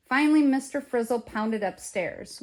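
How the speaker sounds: ordinary delivery, nothing marked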